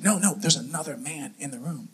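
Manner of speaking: hushed, low insistence; imitated urgent whisper. Wife corrects her newlywed husband, imitated urgent whisper. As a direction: insist under your breath. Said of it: "She's like".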